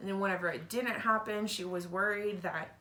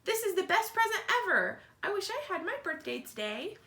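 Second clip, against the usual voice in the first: put-on much higher happy kid voice; excited child; 9 semitones higher than usual. Child at the birthday party, excitedly higher. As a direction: gush like a delighted party guest.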